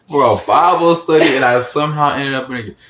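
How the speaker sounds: ordinary talk, nothing marked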